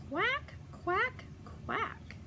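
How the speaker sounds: high, soft little quacks; duckling quacks. Acted. Ducklings answering Mama, soft high quacks. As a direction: peep the quacks like tiny ducklings.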